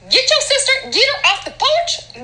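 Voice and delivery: put-on high-pitched holler to a child; calling to kids. Parent ordering Daryl to fetch sister, high holler. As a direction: call out high across the yard.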